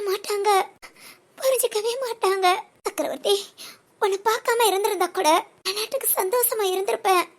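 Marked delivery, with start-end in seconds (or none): 0.1-3.3 tearful. Acted. To her old friend, about men who never understand, crying. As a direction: voice breaking into a sob. Heartbroken woman lamenting men.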